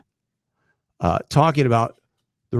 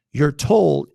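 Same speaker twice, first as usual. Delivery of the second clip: ordinary talk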